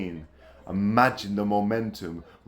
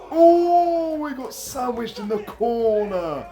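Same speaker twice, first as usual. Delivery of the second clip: excited high cry; 13 semitones higher, 5 dB louder, slower than usual. Real, not acted. Wrestler sandwiched in the corner.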